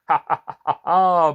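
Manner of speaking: loud hearty cackle; jolly cackle. Acted. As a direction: chuckle at your own tale. Narrator laughs at farewell.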